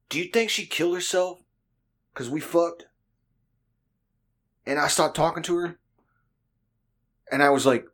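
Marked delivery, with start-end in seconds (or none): 0.1-5.8 imitated buddy, higher voice, halting question; retold heartfelt question in raised pitch; earnest buddy. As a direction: ask it haltingly and high, as a mock-earnest friend.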